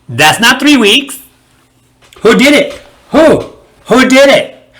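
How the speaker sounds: high, loud, hurt demand; a jilted lover demanding who did it; jealous outburst. Retold or imitated. Jealous barber confronts.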